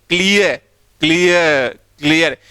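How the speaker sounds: क्लियर with a long "ए" and no "र"; silent r demo. Shown as a demonstration. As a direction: stretch क्लियर as "क्लिय-अ", dropping the r. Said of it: "तभी ये silent हुआ है आर". ("र" silent करके क्लियर)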